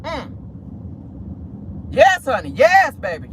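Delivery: normal talking voice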